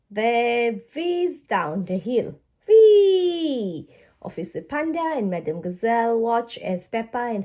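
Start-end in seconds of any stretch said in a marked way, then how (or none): 2.7-3.9 high, loud whoop of delight; thrilled downhill whee; gleeful whee. Acted. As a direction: squeal with joy riding downhill. Children whizzing downhill.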